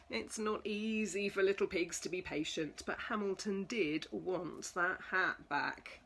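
ordinary delivery

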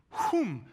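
high, loud "whoom" sound effect; whoosh noise. Shown as a demonstration. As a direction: voice a sudden high swoop. Imitated whoosh as heart is revealed.

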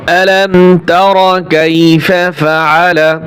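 slow, loud, chanted recitation; Quran recitation. Shown as a demonstration. Teacher reciting verses aloud for the class. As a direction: chant the verses slowly in full voice.

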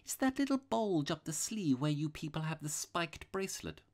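high-pitched apologetic priest; mild little priest. A narrator's reading. Priest admits suspecting the robber, apologetic. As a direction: explain meekly in a high voice.

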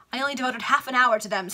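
lightly voiced vain baronet; hurried boast. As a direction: rush the humble brag.

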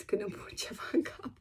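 whispered with a laugh; a giggly whispered confession. Real, not acted. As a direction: breathe it out with a chuckle. Confiding her stubbornness, laughing.

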